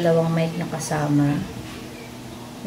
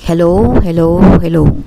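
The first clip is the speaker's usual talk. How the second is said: louder hellos picked up by the clip-on mic; amplified mic-test hellos; through a lavalier mic; 12 dB louder than usual. Shown as a demonstration. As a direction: call hello into the wireless mic. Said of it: "ito yung with mic".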